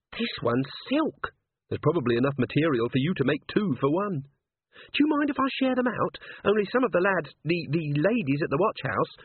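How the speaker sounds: high-pitched, hesitant character voice; high voice, modest and polite; eager, timid Cherie. Acted. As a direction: pitch it up, picking words with care. Cherie's voice in the story.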